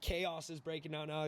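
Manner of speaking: ordinary delivery, nothing marked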